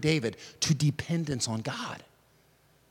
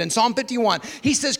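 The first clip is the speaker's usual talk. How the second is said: ordinary delivery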